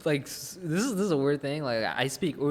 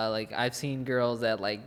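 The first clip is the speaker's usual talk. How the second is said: usual speaking voice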